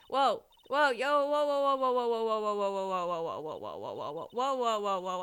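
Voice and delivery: loud rapid string of whoas sliding down in pitch; alarmed whoas. Real, not acted. Player reacts as the game runs by itself, alarmed whoas.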